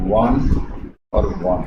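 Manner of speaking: won said like "one"; pronunciation demo. Shown as a demonstration. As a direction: say won as "wun", same sound as one. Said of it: "इसका उच्चारण दोस्तों वन होता है".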